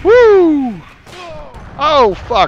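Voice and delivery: high, loud whoop; excited yell. Real, not acted. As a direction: shriek with excitement then dismay. Dramatic moment in the game.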